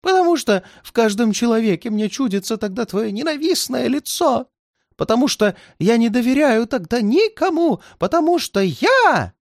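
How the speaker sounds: high, strained cry that breaks off; voice breaking. A narrator's reading. Old man pleads until his voice breaks, high and strained. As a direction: let the voice crack at the end. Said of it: "Голос старика надорвался".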